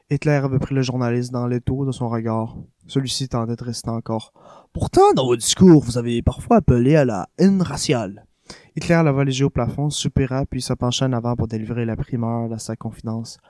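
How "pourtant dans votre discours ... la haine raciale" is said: lightly voiced American journalist, a bit louder. The journalist challenging Hitler, lightly voiced. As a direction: press him with the question.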